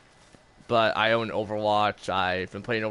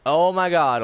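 surprised; 5 semitones higher, 4 dB louder than usual. Real, not acted. Surprised at chaos.

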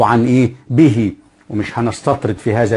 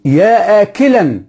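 higher, louder, slow يا آكلًا with a long آ; slow example call; 8 semitones higher, 4 dB louder, slower than usual. Shown as a demonstration. Teacher says listen, then calls out the example. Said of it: "اسمع".

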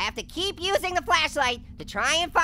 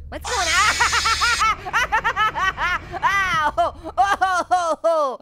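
long, loud yell broken into staccato cries; startled wail trailing into "oh, oh"; 3 dB louder, much slower than usual. Real, not acted. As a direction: yell out in shock at a jumpscare. Jumpscare reaction in horror game.

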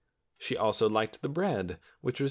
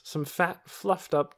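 ordinary delivery

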